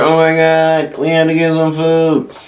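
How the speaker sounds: high, held-up imitation voice; frantic girlfriend impression. Girlfriend fretting over a stray cat, high mock panic. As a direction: mimic her fussing in a high pitch.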